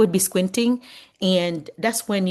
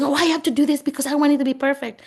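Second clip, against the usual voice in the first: fussy perfectionist; 9 semitones higher, 4 dB louder, faster than usual. Retold or imitated. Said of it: "if you're saying".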